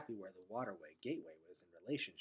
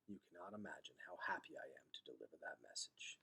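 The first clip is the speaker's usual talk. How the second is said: hushed whisper; 7 semitones lower, 11 dB quieter than usual. Acted. Vicus pleased, reading dialogue.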